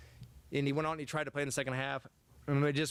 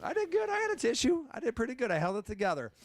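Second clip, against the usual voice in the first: squeaky, much higher insistence; high mock protest; 9 semitones higher than usual. Coach insisting to teasing colleague he held it together, high mock protest. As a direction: protest way up high like a kid caught crying. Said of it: "I said".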